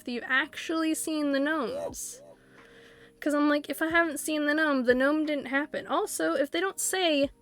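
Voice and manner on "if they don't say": normal talking voice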